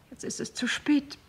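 a whisper of regret, too late now; whispered with sorrow; regretful whisper. Acted. Widow's regret over John.

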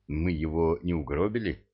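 frightened. A narrator's reading. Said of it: "испугался лейтенант".

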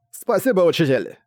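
eager burst of thanks; delighted. Acted. Student delighted at new lesson.

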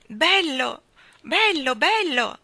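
loud, high, delighted shouting; excited cry. A narrator's reading. Jane delighted after the carousel ride. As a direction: burst out with joy. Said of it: "gridò Jane con gli occhi sfavillanti".

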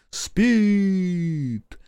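high, long-held chanted cry; crowd response. A narrator's reading. Crowd echoes the leader, high drawn-out cry. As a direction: hold the word high like a crowd. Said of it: "гаркнула в торе ему толпа".

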